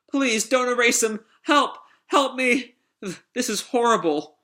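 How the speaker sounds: high, panicked pleading; desperate android. Acted. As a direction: cry for help in a very high voice. Pino begs for help.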